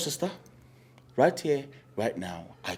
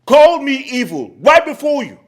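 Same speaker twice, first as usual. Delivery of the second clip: indignant raised voice; 7 semitones higher, 7 dB louder than usual. Acted. Uncle complains indignantly.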